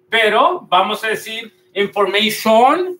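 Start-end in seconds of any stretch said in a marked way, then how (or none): 1.7-3.0 "information" as "in-for-mei-SHON", stretched full O; wrong Spanish-style pronunciation. Shown as a demonstration. Teacher tests learners with the mistake, mispronounced. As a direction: end "information" on a rising, heavy "shon". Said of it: "no vamos a decir information".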